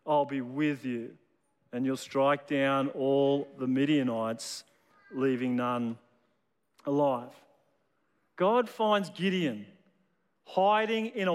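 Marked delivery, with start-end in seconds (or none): 0.1-7.3 voice of God. Acted. Preacher voicing God's reply to Gideon, low and solemn. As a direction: speak it low and grave, as God.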